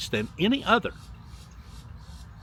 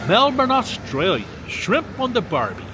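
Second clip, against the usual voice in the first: put-on higher-pitched, playful Australian accent; mock Australian accent; 6 semitones higher than usual. Greeting Melbourne listeners with an Aussie catchphrase, mock Australian accent.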